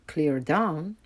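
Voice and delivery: ordinary delivery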